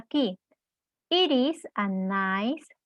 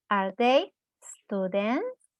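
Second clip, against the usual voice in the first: slow model question, "students?" rising at the end; question intonation; 2 semitones lower, slower than usual. Shown as a demonstration. Teacher repeating the question's intonation for learners, final rise on "students?". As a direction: say "Are they students?" slowly, ending on a rise. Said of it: "the, the intonation".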